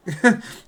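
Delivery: short high laugh bursting out; real burst of laughter. Real, not acted. Laughing at his own quip about the Joker scene. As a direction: crack up at your own joke.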